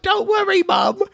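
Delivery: high, shouted cheery reassurance; mock casual reassurance. Tony soaked in blood reassuring Mom.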